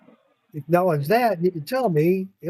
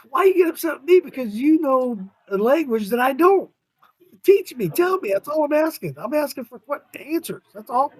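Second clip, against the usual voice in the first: upset pleading; 6 semitones higher than usual. Retold or imitated. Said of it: "I start getting upset too".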